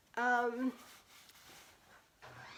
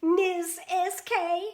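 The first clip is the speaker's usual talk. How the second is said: louder, higher, spelling out her name proudly; name called out loud; 5 semitones higher, 7 dB louder, much slower than usual. Real, not acted. Painter spells her name loudly for Bobby, announcing. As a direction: announce each letter clearly.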